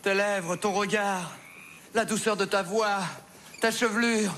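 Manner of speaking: rising from slow swoon to high cry; melodramatic lament. Acted. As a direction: overplay the dying lover, climbing high. Mock-dramatic death lament rising.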